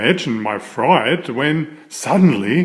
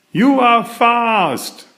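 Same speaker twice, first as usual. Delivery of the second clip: higher, slow little rabbit voice; passing rabbit; 9 semitones higher, slower than usual. Acted. A passing rabbit calls to the riders, in a high slow little voice. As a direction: call out like a small rabbit hopping by. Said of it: "called a passing rabbit".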